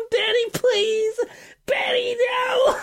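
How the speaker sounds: high, wailing, drawn-out mock plea; imitated screaming. Patient reliving it in hypnotherapy, mock screaming. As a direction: plead in hammed-up hysterics. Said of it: "And she was screaming".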